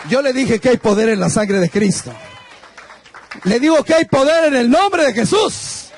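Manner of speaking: loud, shouted praise from the pulpit; preacher lifting the church to a loud shout of praise; preaching at full force. Real, not acted. Preaching climax.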